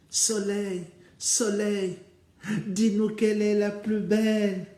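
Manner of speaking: loud, high summons to the sky; calling out. Acted. Sisters calling up to the sun, loud and high. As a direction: call up to the sun.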